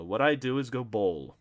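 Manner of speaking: plain reading voice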